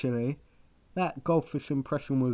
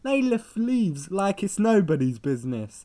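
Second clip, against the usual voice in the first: louder, higher indignant rant; 8 semitones higher, 8 dB louder than usual. Acted. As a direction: rant over them, won't stop. Defends ants, talking over partner.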